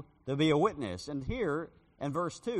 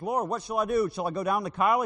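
pleading David; 6 semitones higher, 9 dB louder than usual. Acted.

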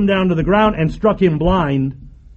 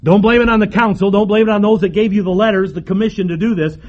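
ordinary delivery

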